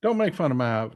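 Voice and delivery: ordinary delivery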